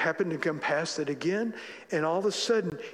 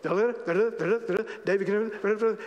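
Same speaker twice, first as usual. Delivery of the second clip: mock speed-reading; 5 semitones higher than usual. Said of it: "you'll go".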